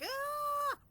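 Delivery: sudden high, loud burst of exasperation; mock meltdown yell; frustrated scream. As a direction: let out a short screech of overwhelm. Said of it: "there are days where I'm like".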